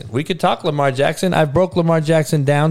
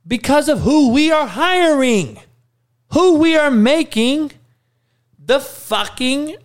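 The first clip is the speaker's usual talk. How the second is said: heated rant; 10 semitones higher than usual. Real, not acted. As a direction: rant it, voice climbing. Ranting about who gets appointed.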